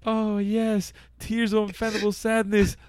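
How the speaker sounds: hint of a gleeful bully; mock Cartman gloat. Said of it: "Cartman goes up to him like".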